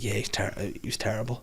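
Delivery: half-voiced, as if hushed; quiet mock interviewee; soft retold quote. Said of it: "this woman was like".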